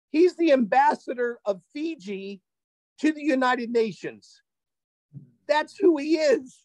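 high, wonder-struck announcement; amazed reveal. Real, not acted. Storyteller revealing his host's identity, amazed. Climactic reveal of who host is.

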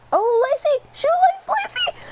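climbing, very high squeal; real high-pitched gamer panic; frantic excitement. Real, not acted. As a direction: urge the runner on, pitch shooting up. Urging on a runner in game.

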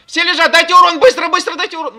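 screaming very loud and high; frantic yelling. Real, not acted. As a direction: scream for damage, desperate. Raid leader desperate for damage.

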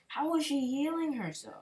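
high, loud, indignant question; can't believe she healed; outraged disbelief. Real, not acted. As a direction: gamer protesting the opponent's healing, indignant. Outraged at losing.

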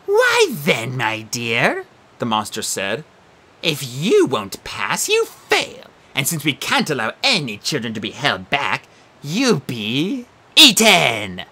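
slow, sinister monster threat; menacing Sphinx. Acted. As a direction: threaten the child sweetly.

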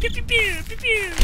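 high pew pew sound effects; playful toy-gun pews; mouth gun noises. Pretend. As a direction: fire imaginary shots. Imitates gunfire sounds.

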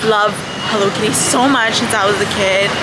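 ordinary talk

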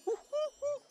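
very high falsetto hooting; cartoon whoop. Gamer finishing the episode quote, high cartoon whoop. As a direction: squeal a bouncing woo-hoo.

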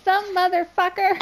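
high squeaky mock hamster voice; imitating hamster voice. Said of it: "It's like".

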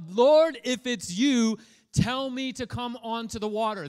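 loud, high call across the water; yelling out. Retold or imitated. Peter calling to Jesus from the boat, loud and high. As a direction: yell it out to Jesus. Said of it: "and he yells out".

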